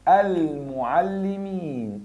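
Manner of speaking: المعلمين slow, with a long -een ending; accusative form shown. Shown as a demonstration. Teacher reminds students to use the accusative, ending drawn out. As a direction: stretch المعلمين syllable by syllable into al-mu'allimeen. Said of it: "انصب، اوعى تنسى".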